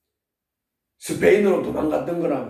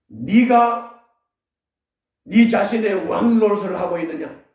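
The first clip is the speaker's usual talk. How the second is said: preaching challenge; 5 semitones higher, 3 dB louder than usual. Real, not acted.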